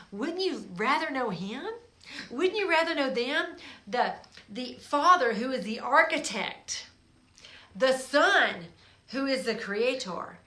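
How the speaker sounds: loud, high, rising preaching; full-force preaching. Acted. Preacher urging the listeners to know the Trinity, loud and high. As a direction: proclaim it to the back row.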